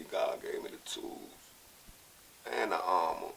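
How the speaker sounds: ordinary delivery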